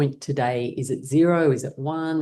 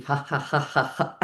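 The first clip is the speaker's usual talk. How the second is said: warm-up laugh; 5 semitones higher than usual. Shown as a demonstration. Teacher gets whole body working, building exercise laugh. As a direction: fake it until it rolls into laughing. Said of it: "Helps to get the whole body working".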